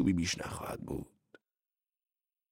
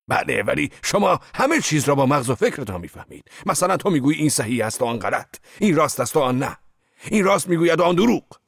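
loud, higher, mounting scorn, faster at the end; heated retort; 9 semitones higher, 6 dB louder than usual. Acted. Zorba lecturing his boss, loud heated scorn. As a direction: hammer each contrast harder.

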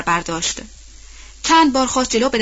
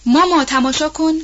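put-on high, louder call of a little girl; child's shout; 6 semitones higher, 4 dB louder than usual. Girl on her horse calling her mother, excited shout. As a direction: call out eagerly to mama. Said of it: "بونی بار دیگر فریاد زد".

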